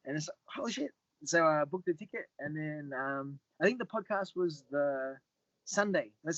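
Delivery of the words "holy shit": amazed. Retold or imitated. Retold amazed reaction.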